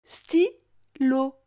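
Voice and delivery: "Stylo" as sty... lo, pause between; split into syllables. Shown as a demonstration. Teacher helping the child hear lo, syllable by syllable. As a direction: break Stylo into two separate syllables. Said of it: "Tu entends bien la syllabe lo".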